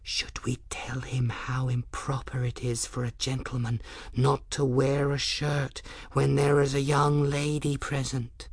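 hushed stage whisper. A narrator's reading. Young woman consulting unseen company about the shirtless man, whispered. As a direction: whisper it as a confidential aside. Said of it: "and whispered".

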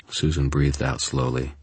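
ordinary delivery

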